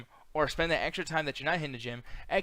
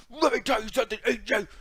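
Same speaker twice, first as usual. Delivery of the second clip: high, loud gravel-less Macho Man growl; 8 semitones higher, 6 dB louder than usual. Retold or imitated. Mocking Samoa Joe imitating Macho Man on SmackDown. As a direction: ham it up like Macho Man cutting a promo. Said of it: "he turns into Macho Man".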